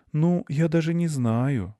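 high, slow, wavering reply; thoughtful mother. A narrator's reading. Mother wavering at her son's plea, thoughtful. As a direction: hesitate and think it over aloud. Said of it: "сказала мама задумчиво".

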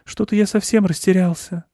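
high-voiced, bewildered mouse; lost little mouse; sighing. A narrator's reading. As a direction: confess it with a high little sigh. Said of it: "вздохнул мышь".

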